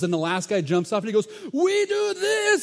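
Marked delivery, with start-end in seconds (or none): 1.5-2.6 very high, slow, strained boast; hammed-up muscleman. Last guy jumps off to answer host, hammed-up boast. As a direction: stretch out a bodybuilder's mock boast.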